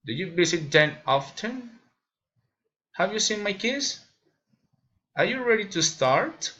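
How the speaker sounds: put-on "often", "kids" and "start" lifted at the end; rising intonation. Shown as a demonstration. Learner demonstrating general questions, rising intonation. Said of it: "raising intonation".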